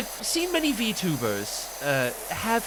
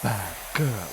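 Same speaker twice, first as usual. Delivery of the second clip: lower, purring vampire dandy; a lightly voiced fake Astarion; 8 semitones lower, 4 dB quieter than usual. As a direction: tease like a wounded aristocratic vampire. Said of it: "I call it fake Astarion".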